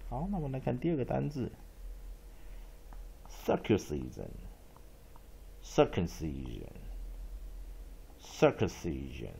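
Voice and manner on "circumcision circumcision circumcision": slow, low, drawn-out model reading; slow teacher demo; slow modelling. Shown as a demonstration. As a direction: stretch "circumcision" out for students. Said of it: "teacher models new word".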